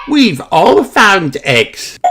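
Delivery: high, slow and contented; little Peppa. A narrator's reading. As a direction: pitch up and draw it out happily. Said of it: "says Peppa".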